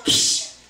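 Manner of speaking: sharp hissed psst; furtive psst. Acted. Candy calling the preacher over, furtive psst. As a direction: hiss for someone's attention. Candy calls to him again.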